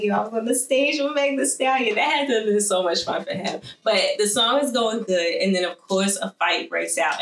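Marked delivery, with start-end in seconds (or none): 0.0-1.9 imitating [name]. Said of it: "he was like".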